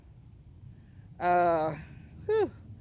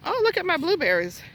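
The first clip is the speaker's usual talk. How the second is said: delighted; 10 semitones higher than usual. Real, not acted. Delighted at spotting blueberry row.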